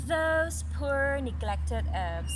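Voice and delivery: slow, loud and lofty pity; haughty apple branch. Acted. The apple branch pitying the poor herbs, slow and lofty. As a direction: look down on the herbs from on high. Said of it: "said the apple branch".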